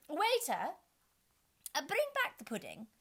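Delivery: high, loud call to the waiter. A narrator's reading. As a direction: call out across the room. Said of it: "she called out".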